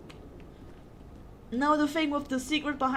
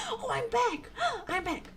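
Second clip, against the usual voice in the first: higher, joyful cry of relief; delighted exclamation, higher; excited relief; 6 semitones higher, 4 dB quieter than usual. Real, not acted. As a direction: celebrate being back. Relieved to return to office.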